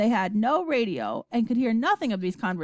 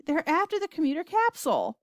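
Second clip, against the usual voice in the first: high-pitched alarmed cry; 8 semitones higher than usual. A narrator's reading. Boy seeing the capsule in danger, crying out. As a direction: call the news out urgently. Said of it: "cried Charlie".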